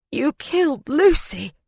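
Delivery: high, anguished cry of accusation. A narrator's reading. Husband accusing his lover of killing his wife, crying out. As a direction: hit it harder, voice high with grief. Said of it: "he cries".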